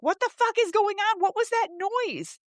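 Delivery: high, alarmed demand to know; retold alarm. People banging on the door after hearing a crash, high retold alarm.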